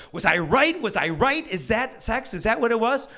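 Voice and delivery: mock kid's thrilled questioning; excited daughter. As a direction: fire off rising questions, lit up. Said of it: "My daughter's eyes lit up".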